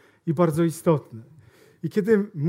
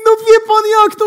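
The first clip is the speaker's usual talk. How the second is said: mock salesman, much higher and louder; pitched-up, loud bragging salesman; eager salesman; 12 dB louder than usual. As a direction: ham up the overeager salesman. Boastful salesman retold.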